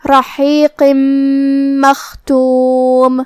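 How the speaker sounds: رحیق مختوم slow, the tanween merged into م with a held nasal hum; tajweed demo. Shown as a demonstration. Teacher demonstrating the merge for students, slow and nasalised. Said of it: "تنوین کو میم میں ملا کے غنہ".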